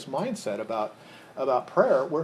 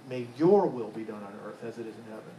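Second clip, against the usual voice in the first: YOUR lifted out of a lower-pitched line; stress on YOUR; 6 semitones lower than usual. Shown as a demonstration. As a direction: lean on "your", then let it fall. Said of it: "See the contrast there?".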